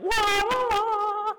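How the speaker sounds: very high, loud, squeaky calls to lure the dog; coaxing dog call. Retold or imitated. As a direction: crouch and coax a runaway dog with shrill high wah sounds. Staring down runaway dog.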